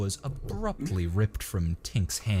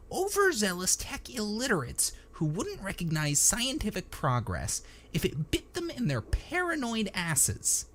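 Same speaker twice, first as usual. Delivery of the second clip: high-pitched whiny rant. A narrator's reading. Techie denouncing narrow-minded tech illiterates, high whine. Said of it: "Tink's inherently whiny voice".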